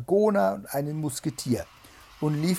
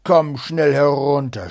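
shouting; 2 semitones higher, 14 dB louder than usual. A narrator's reading. Bluebeard orders his wife downstairs, shouting. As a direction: bellow the order down the house. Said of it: "schrie Blaubart".

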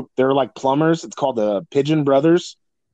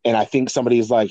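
usual speaking voice

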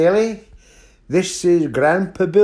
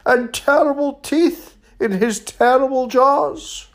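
high, emphatic little mouse; higher-pitched mouse, punching each item; boastful mouse; 8 semitones higher, 4 dB louder than usual. Acted. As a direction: describe the monster with storybook relish. Mouse figure describes the monster.

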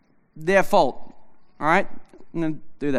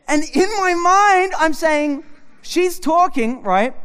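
usual speaking voice

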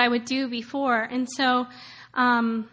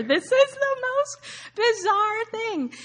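higher, slow amazed disbelief; higher incredulous wonder; 10 semitones higher than usual. Retold or imitated. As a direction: let it rise in bewildered shock. Retold disbelief at airport agent.

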